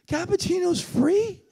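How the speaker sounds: high, slow, drawn-out rise on "free"; incredulous question. Retold or imitated. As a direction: savour the disbelief, stretching it upward. Repeats the attendant in disbelief.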